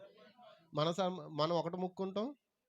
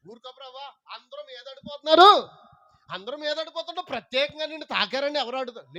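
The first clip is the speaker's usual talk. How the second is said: dismissive; 8 semitones higher, 9 dB louder than usual. Acted. Peter brushing off Jesus.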